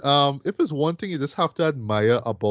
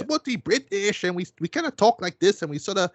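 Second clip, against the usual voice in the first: exaggerated cockney caricature, pitched up; mock the film's parents in a hammy cockney accent; cartoonish cockney; 7 semitones higher than usual. Reviewer mocking Matilda's parents, cartoonish cockney caricature. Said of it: "kinda cartoonish, cockney".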